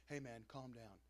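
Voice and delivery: soft, low calming voice; soothing hush. Retold or imitated. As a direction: gently settle him down. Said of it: "it's not just like".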